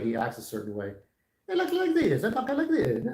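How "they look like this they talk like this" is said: much higher, cartoon Italian Mario voice. Defending Mario's voice to chat, he mimics Mario high and Italian. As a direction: pop into a squeaky Mario impression. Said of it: "Mario talks a certain way".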